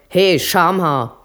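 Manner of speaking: loud, slow taunting call across the bar. Acted. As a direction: shout the insult to get his attention. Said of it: "ruft ziemlich laut". (lauter, höhnischer Ruf quer durch die Kneipe)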